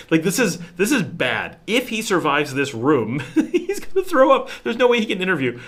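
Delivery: high, loud, laughing disbelief; wife laughing in alarm that he can't interview. Retold or imitated. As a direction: laugh while warning he'll throw up.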